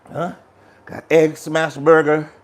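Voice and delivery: normal talking voice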